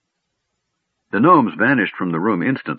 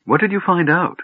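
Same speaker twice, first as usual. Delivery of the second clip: high, tentative question; hesitant; 10 semitones higher than usual. A narrator's reading. Tas approaching the forbidding mage, hesitantly. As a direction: ask it carefully in a raised pitch. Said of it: "hesitantly approaching Fizban".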